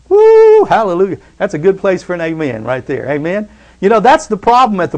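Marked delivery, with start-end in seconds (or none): 0.1-0.6 a sudden high, loud whoop of praise; exultant high shout; joyful whoop. Real, not acted. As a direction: let out a big preacher's woo. Preacher's burst of joy.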